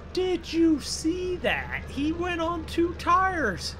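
high, stretched-out astonished cry; amazed. Real, not acted. Spectator amazed by a stunt on two tires, astonished. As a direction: draw it out in high-pitched amazement.

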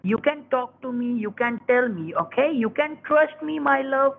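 soft, low, coaxing voice; reassuring. Real, not acted. Caller asking customer to trust him, reassuring. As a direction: coax gently to win their trust.

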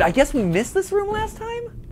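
high, nervous questioning; unsure and a bit spooked; scared horror game streamer. Real, not acted. New room in horror game.

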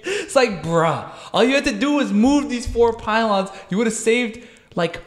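high, disbelieving complaint; exasperated. Real, not acted. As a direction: vent like it's obviously stupid. Exasperated rant at the workers.

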